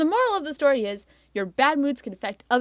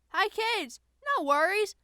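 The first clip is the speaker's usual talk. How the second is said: put-on mild kids' TV presenter; children's host; 2 semitones higher, 7 dB quieter than usual. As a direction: talk gently to children. TV host speaking to kids.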